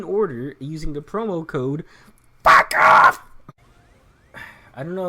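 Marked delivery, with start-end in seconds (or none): 2.4-3.2 sudden loud shout to end the fake ad; shouted punchline. Mock sponsor read that ends in a loud shouted insult. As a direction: blurt it out loud as the twist.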